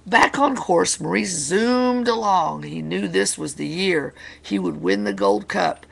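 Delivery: excited, high-energy picture-book narration; animated storyteller in full swing; dramatic story reading. Acted. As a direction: read it big for children, starting high and loud. Reads picture book to children.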